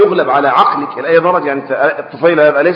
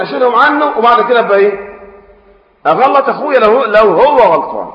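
raised, louder passionate protest; impassioned; 6 semitones higher, 3 dB louder than usual. Real, not acted. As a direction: push back hard, voice climbing. Impassioned rebuttal in sermon. (احتجاج حماسي بصوت أعلى)

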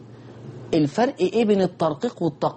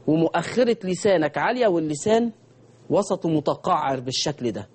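ordinary talk

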